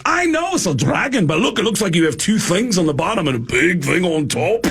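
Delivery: high mock rocker voice; rock-singer voice. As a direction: explain it like the blunt rock star.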